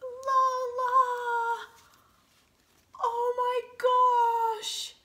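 loud, high, drawn-out amazement; overwhelmed delight. Real, not acted. Woman sees a carving of Lola, overwhelmed delight. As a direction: cry out in thrilled disbelief. Discovers carved portrait of Lola.

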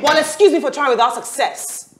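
sarcastic retort. Acted. Woman stung by his criticism, sarcastic retort.

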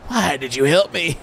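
touched surprise; surprised plea. Acted. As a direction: ask in wonder. Surprised gratitude.

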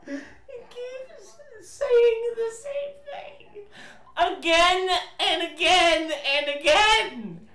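high, slow, laughing complaint; laughing exasperation. Real, not acted. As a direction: crack up while complaining.

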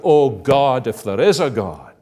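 loud, doubting outcry to God; crying out. Acted. Unbeliever in distress calls on God, loud crying out. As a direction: cry out loudly, then ease off. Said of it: "they don't cry out".

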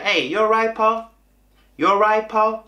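high, run-together Manchester greeting; Manchester accent. Shown as a demonstration. Waiter greets customers as locals do, Manchester accent. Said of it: "Yo decía".

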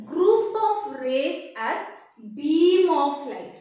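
group of rays as beam of light, slow and loud on beam; slow emphatic definition. Shown as a demonstration. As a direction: stretch out each word of beam of light, firmly. Teacher defines a new term.

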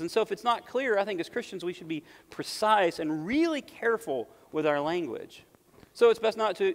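rising pulpit intensity; full-force preaching. Acted. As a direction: preach it out to the whole room. Preacher pressing the point hard.

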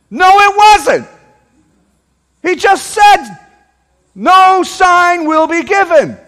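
high, forceful preacher's yell. Real, not acted. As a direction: shout it at the congregation, hard on every word. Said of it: "didn't mean to yell at you".

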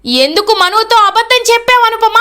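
గట్టిగా నిలదీయడం; loud demand. A narrator's reading. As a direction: press her for the truth, voice rising. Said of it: "గట్టిగా అడుగుతాడు".

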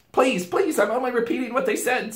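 put-on high, frightened pleading boy; stammering. A narrator's reading. Boy defending himself to the queen, stammering. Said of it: "stammered Edmund".

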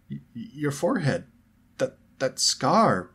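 shocked stammer. A narrator's reading. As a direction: stumble over the words, stunned. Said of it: "stammered George".

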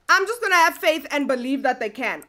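normal talking voice

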